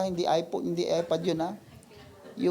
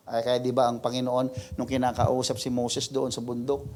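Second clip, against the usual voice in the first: ordinary talk, nothing marked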